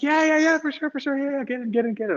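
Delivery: high, eager repeated yeahs; eager tuk-tuk driver. Retold or imitated. Driver urging stranded traveler to get in, high and eager. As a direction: imitate a keen driver waving you in.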